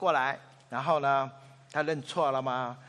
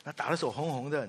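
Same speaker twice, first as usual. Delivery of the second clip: ordinary talk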